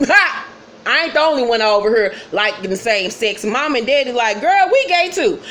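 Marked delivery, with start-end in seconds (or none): 0.1-0.4 high, loud single laugh; burst of laughter. Real, not acted. Amused by her own retelling. 4.4-5.4 pitched-up, playful retort; mock parental announcement. Parents coming out to daughter, playful high-pitched imitation. Parents' comeback in retold scene.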